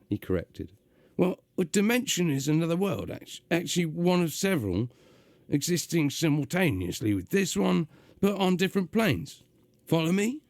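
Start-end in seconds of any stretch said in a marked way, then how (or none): 1.2-10.4 gruff, high London demon voice; gruff London accent. Acted. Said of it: "doing a gruff, uh, London accent".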